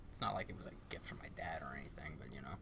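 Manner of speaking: soft unvoiced whisper, trailing off; muttered aside. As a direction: whisper it as if it doesn't matter. Downplaying dad's gift.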